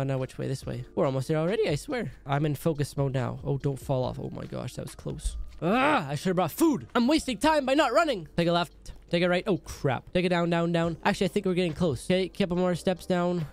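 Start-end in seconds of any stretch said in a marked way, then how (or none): none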